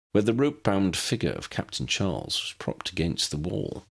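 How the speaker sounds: usual speaking voice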